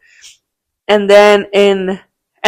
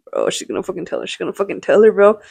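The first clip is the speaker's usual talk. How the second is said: panicked whisper; 7 dB quieter than usual. Retold or imitated. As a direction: dread it under your breath. Panicking as teachers talk.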